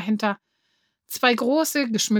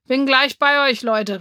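loud, raised holler to friends; calling out; 3 semitones higher, 5 dB louder than usual. A narrator's reading. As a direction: shout ahead over the crowd. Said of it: "rief er".

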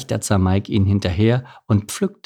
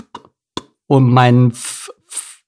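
stuck on the first sounds of words; stammer, breaking off after each consonant; 3 semitones higher, 5 dB louder, much slower than usual. A narrator's reading. Mike caught out by the officer, stammering. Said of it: "stammelte Mike".

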